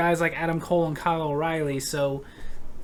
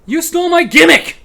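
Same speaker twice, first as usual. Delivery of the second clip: mock outrage; 8 semitones higher, 11 dB louder than usual. Angry commenter accuses rival.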